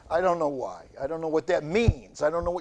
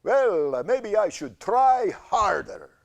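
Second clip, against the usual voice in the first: higher, louder mock menace; Lugosi-style cancer; 7 semitones higher, 6 dB louder, slower than usual. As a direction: tease like a horror villain. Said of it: "reminds me of Bela Lugosi".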